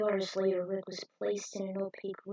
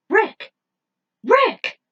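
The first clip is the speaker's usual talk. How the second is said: loud, high, worried calling; urgent calling; 13 semitones higher, 13 dB louder than usual. Acted. Falcon trying to wake the injured Rick, urgent calls. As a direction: shout to wake a friend. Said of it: "Tried waking him up".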